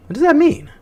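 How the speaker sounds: high put-on questioning voice; goofy high mock question. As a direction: ask it in a silly cartoon voice. Mocking the trailer line.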